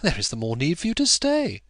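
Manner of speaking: playful wife to guest; playfully. A narrator's reading. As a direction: tease him into staying. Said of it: "said Rosamond playfully and in her lightest accent".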